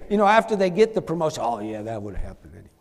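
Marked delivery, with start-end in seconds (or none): 1.4-2.7 low, quick, quiet brush-off; mock dismissive. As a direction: shrug it off fast and low, as if owing nobody. Imitates dismissal after getting promotion.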